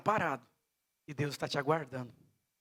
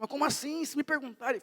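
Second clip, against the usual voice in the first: put-on young woman's protest; 10 semitones higher than usual. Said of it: "Ela falou".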